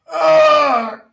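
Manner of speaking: loud stretching groan; big grunt while stretching; effort grunt. Real, not acted. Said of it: "Sorry for those grunting noises".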